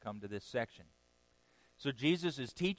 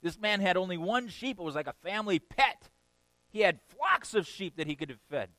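higher indignant outburst; angry David; 6 semitones higher, 4 dB louder than usual. Retold or imitated. David outraged at the rich man, higher and indignant. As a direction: protest the injustice with rising heat. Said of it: "He gets angry and says".

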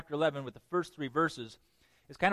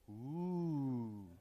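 impressed ooh; 4 semitones lower, 11 dB quieter than usual. Shown as a demonstration. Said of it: "You should all go".

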